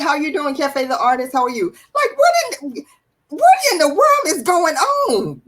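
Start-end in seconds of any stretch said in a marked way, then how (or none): 1.9-5.4 high, slow, laughing "what in the world"; outraged disbelief. Real, not acted. Host to viewers, stretched-out laughing disbelief.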